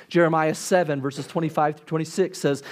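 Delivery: usual speaking voice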